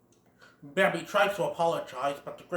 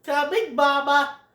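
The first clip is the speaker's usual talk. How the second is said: higher, louder sing-song reply; obedient kid Thumper; 10 semitones higher, 6 dB louder than usual. Retold or imitated. Thumper answering his mother, sing-song. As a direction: answer mom like a good bunny. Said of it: "Thumper's all like".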